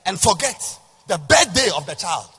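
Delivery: loud, high, building sermon shout; preaching. Acted. Pastor proclaiming God's words to congregation. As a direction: proclaim God's promise at full force. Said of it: "I'm preaching".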